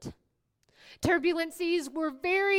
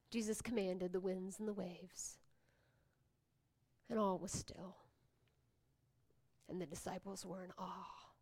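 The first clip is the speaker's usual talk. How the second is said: softer, whispered awe; hushed narration; 7 semitones lower, 15 dB quieter than usual. Acted. Preacher telling the congregation of the calmed sea, hushed. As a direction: let the hush fall over the room.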